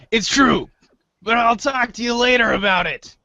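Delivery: ordinary delivery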